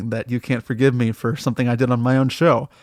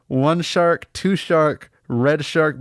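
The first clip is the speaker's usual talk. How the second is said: ordinary delivery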